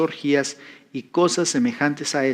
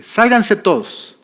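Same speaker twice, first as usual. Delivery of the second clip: slow, raised order to clear the room; firm command; 6 semitones higher, 3 dB louder, slower than usual. Retold or imitated. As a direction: order everyone out, firmly and slowly. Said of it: "el pastor le dice".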